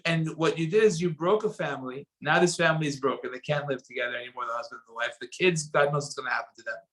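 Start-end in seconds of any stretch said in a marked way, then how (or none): none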